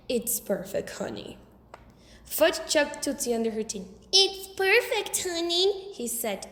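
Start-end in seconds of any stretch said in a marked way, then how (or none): 0.1-1.4 lightly voiced dad, low and soft; a hint of affectionate father; fond husband. A narrator's reading. Dad answering Mom fondly, soft and low. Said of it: "my father smiled back and said". 4.1-5.9 high, slow mocking copy of Dad; imitating Dad. A narrator's reading. Fudge mocking his father's reply, high and slow. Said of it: "imitating my father".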